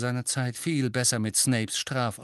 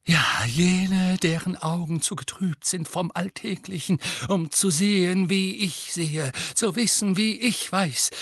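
high, theatrical, quavering prophecy; wronged prophetess laments her fate, lightly wavering; dramatic seer; 7 semitones higher than usual. Acted. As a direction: declaim it like a persecuted seer. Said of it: "mit tiefer, dramatisch wabernder Stimme".

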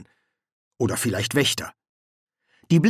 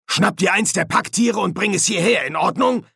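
higher, energetic sergeant giving orders; bossy sergeant; 8 semitones higher, 4 dB louder than usual. Acted. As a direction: hand out the order as the one in charge. Colon orders Nobby.

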